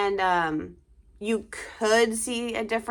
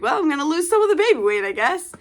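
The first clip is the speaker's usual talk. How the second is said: quick, higher, louder joking aside; tossing off a silver lining with a grin; wry inner thought; 8 semitones higher, 6 dB louder, faster than usual. Retold or imitated. Mom joking to viewers about losing baby weight, quick wry aside.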